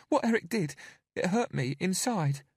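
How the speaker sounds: put-on high, small frightened child; timid little girl pleading; scared little girl. A narrator's reading. Narrator voices Bella.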